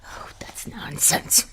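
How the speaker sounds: soft whispered brush-off; whispered dismissal. Acted. Wife dismissing husband's worry, whispered. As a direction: hush him down quietly.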